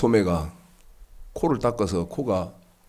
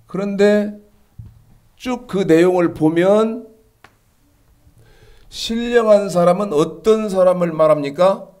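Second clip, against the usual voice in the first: high, driving preacher's voice, pressing each question; fervent preaching; 11 semitones higher, 5 dB louder than usual. Acted. Pastor pressing the congregation about spiritual people, in a high fervent preaching voice. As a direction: preach it up high to stir the flock.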